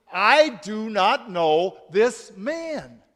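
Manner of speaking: slow, firm denial; sworn denial. A narrator's reading. Peter denying Jesus to the servant girl, slow and firm. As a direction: say each word slowly as an oath. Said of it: "Peter swore an oath, saying".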